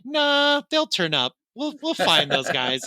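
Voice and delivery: high, dismissive "nah" settling into nonchalance; lazy cops. Acted. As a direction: brush it off like a lazy cop. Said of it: "just lazy and negligent".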